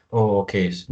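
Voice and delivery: usual speaking voice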